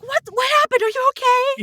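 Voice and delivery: high, alarmed mother's voice; worried mom. Retold or imitated. Mom hearing her daughter scream, high and alarmed. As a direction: sound like a panicked mom. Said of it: "my mom, of course, is like".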